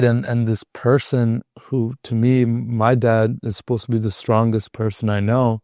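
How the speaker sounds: ordinary talk, nothing marked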